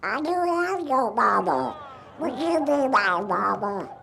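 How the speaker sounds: little cartoon duck voice. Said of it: "Only he said it this way".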